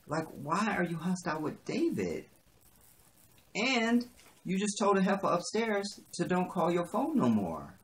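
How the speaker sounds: high-pitched, baffled questioning; disbelieving, exasperated challenge; incredulous. Real, not acted. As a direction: demand an explanation, voice climbing. Questions the character's illogical hostility.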